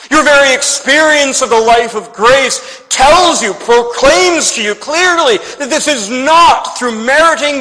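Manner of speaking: impassioned, insistent pulpit appeal; emphatic preacher's challenge; forceful preaching. Acted. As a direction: press the argument home with growing force.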